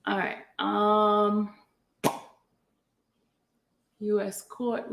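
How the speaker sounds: usual speaking voice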